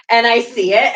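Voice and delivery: ordinary talk, nothing marked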